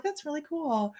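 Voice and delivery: soft, high, lightly impressed quote; a breezy, pleased reaction; mildly impressed. Retold or imitated. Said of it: "So I'm like".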